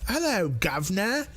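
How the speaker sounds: high, hammed-up British greeting; exaggerated, high-pitched British accent to tease; really thick British accent. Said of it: "really thick".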